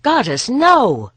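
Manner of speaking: high, loud, slow horrified cry; shocked cry. Acted. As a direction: recoil in sudden shame. Sudden shame at being naked.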